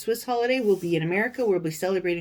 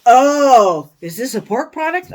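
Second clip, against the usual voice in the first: louder, mocking officer voice; suspicious customs officer; 5 semitones higher, 13 dB louder than usual. Mimicking the officer finding the sausages, loud and mocking. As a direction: ham up the nosy official.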